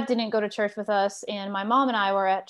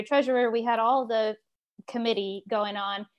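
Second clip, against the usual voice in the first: ordinary delivery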